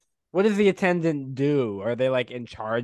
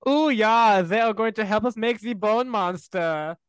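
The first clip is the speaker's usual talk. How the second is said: mimicking Isaac; 7 semitones higher than usual.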